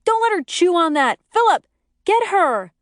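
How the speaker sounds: high, loud urgent call; urgent shout. A narrator's reading. Narrator shouting at Philip to catch dog. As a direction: shout to him across the room.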